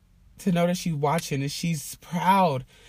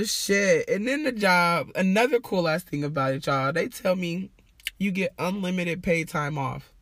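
ordinary delivery

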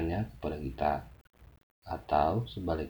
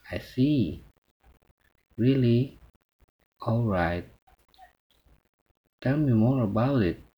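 louder, animated replies to a speaker; lively listener responses; 2 semitones higher, 5 dB louder, slower than usual. Shown as a demonstration. Teacher modelling attentive replies for students, lively. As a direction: show keen interest as you answer.

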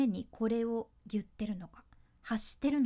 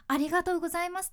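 cheerful; 7 semitones higher, 5 dB louder, much faster than usual. Shown as a demonstration. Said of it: "形式的になってくると".

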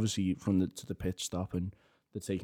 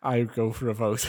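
laughing mock quote. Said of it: "Balestri goes".

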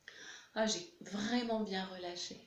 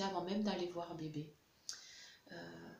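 usual speaking voice

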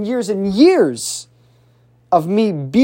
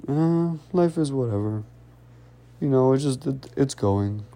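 low, quiet, listless mumbling reply; depressed; 5 semitones lower, 7 dB quieter than usual. Acted. Depressed person answering how's life, low and listless. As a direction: answer as if dragged down, trailing away. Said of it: "They probably talk like this".

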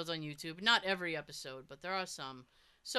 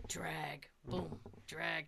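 eerie whispered boom drag; 3 semitones lower, 8 dB quieter, much slower than usual. Retold or imitated. Imitating the dragging leg sound.

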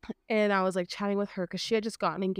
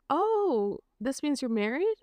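lightly voiced curious kid, pitched up; a hint of a child's voice; 6 semitones higher, slower than usual. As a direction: ask it high like a surprised child. Child's question in retold chat.

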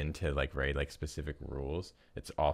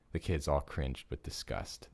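normal talking voice

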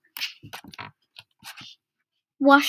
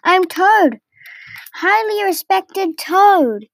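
higher-pitched indignant outburst; indignant temper; 6 semitones higher, slower than usual. A narrator's reading. Toad losing his temper at the barge woman, indignant. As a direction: proclaim it with wounded pride, drawn out. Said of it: "Toad lost his temper".

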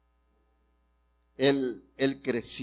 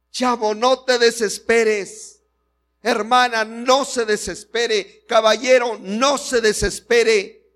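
fervent exhortation; 7 semitones higher, 8 dB louder than usual. Real, not acted. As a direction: call out to each listener in turn. Preacher exhorts the congregation. (exhortación ferviente, más aguda y lenta)

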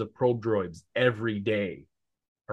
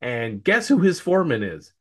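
ordinary talk

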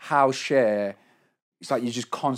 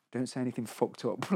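hushed warning; 10 dB quieter than usual. Retold or imitated. In the lift, warning partner before the flat interview: hushed. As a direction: warn your partner quietly so the hosts won't hear.

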